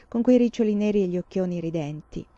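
plain reading voice

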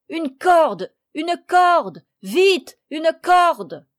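high, loud cries for rope; frantic repeated shouting; urgent shout. A narrator's reading. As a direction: yell for help at top urgency. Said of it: "s'était écrié".

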